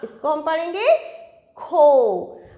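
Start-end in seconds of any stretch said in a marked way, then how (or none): 1.6-2.4 खो with a loud, drawn-out "oh"; खो as one long "khoh"; long O sound. Shown as a demonstration. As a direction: hold the "oh" in खो for the class. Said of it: "आप जब इसका उच्चारण करेंगे तो ओ का साउंड आएगा".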